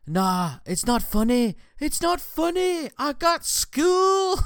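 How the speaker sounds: put-on high, slow, upset Scottish girl; whiny little Scottish girl protesting. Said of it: "And she's like".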